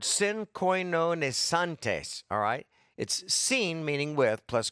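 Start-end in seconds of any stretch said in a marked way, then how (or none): 0.0-2.2 synkoinonesantes said very slowly, syllable by syllable; slow teaching pronunciation of synkoinonesantes; Greek word spelled out. Shown as a demonstration. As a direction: sound out synkoinonesantes carefully. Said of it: "he uses that word".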